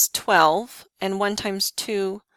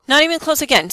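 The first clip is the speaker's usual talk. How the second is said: louder exclaim; 7 semitones higher, 9 dB louder, faster than usual. Real, not acted. Teacher exclaims at failed check.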